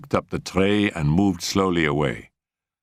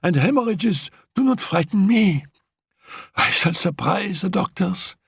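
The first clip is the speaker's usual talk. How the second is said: put-on high, determined voice of a frail girl; stubborn and high, willing herself to live; defiant invalid; 14 semitones higher than usual. Annette vowing to Raoul, high defiant voice.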